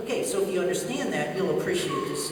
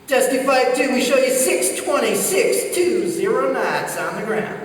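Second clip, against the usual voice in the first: loud, higher radio read-back, slowing at the end; gleeful controller; 5 semitones higher, 5 dB louder than usual. Retold or imitated. Controller putting the Navy pilot in his place, gleeful. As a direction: announce it with barely contained glee. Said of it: "hear the glee in the controller's voice".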